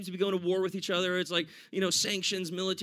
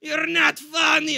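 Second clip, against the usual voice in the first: high, loud, drawn-out mock shout; imitated heckler shout; 6 semitones higher, 6 dB louder, slower than usual. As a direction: yell it slowly as an outraged heckler. Heckler's outburst retold by comedian.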